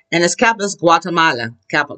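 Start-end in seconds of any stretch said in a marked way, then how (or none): none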